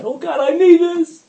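high, loud begging prayer; hammed-up cry to convince God; mock desperate pleading. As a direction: parody of a needy worshipper. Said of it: "You don't have to convince God".

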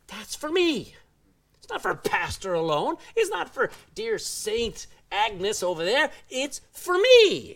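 preacher building to an emphatic it's for me; emphatic claim. Pretend. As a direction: claim the promise as your own, building. Preacher voicing believer's claim.

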